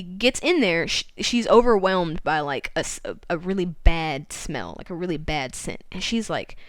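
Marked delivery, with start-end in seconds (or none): none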